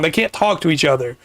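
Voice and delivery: ordinary talk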